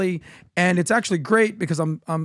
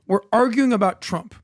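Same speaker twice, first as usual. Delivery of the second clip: normal talking voice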